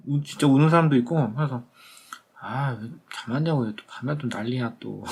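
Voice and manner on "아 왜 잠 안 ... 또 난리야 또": soft, low-key complaint; muted grumbling. Retold or imitated. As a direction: grumble under your breath, as if to yourself. Grumbles to himself about crying patients.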